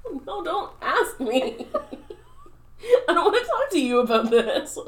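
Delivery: high, wailing protest through laughter; mock distraught refusal. Embarrassed improviser refusing to explain to partner, mock distraught.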